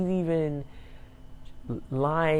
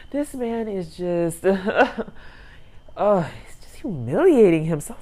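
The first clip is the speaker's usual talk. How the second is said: real laughing disbelief; 4 semitones higher, 5 dB louder than usual. Real, not acted. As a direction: break up laughing, then get louder. Laughing at his embarrassing clowning.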